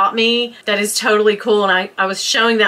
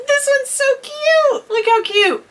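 very high-pitched, gushing coo; delighted cooing. Real, not acted. Vlogger cooing over a cute advent item, delighted.

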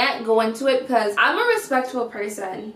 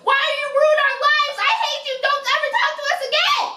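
much higher, rising to a frantic pitch; shrill other girl; 10 semitones higher than usual. Acted. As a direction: beg her to leave them alone, higher and higher. Other girl shouting over phone.

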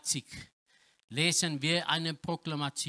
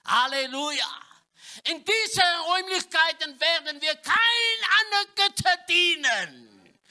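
much higher and louder, shouted praise; full-force preaching; 13 semitones higher, 7 dB louder than usual. Acted. Preacher rouses the congregation to shout Halleluja, full-force preaching. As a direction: rouse the hall to cry Amen.

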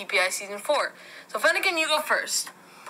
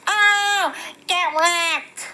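high taunting toy voice; 6 semitones higher, 3 dB louder, much slower than usual. Pretend.

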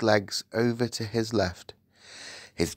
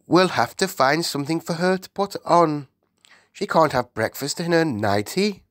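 raised regal voice; practical Queen; 7 semitones higher than usual. Acted. Queen arranges clothes for the girl, practical. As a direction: fuss over arrangements, pitched up.

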